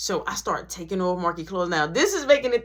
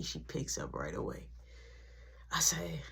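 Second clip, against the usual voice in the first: ordinary delivery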